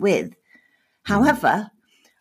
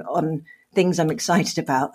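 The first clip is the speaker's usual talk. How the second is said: ordinary talk, nothing marked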